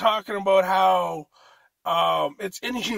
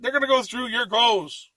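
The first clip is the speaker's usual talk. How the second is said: ordinary talk, nothing marked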